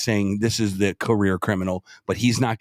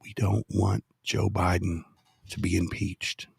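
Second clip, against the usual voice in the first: slow, softer, with measured pauses; deliberately slow; 3 semitones lower, 6 dB quieter than usual. Shown as a demonstration. Host explaining impeachment strategy to co-hosts, slow and deliberate. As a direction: spell it out slowly, phrase by phrase. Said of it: "I'll just say it slowly".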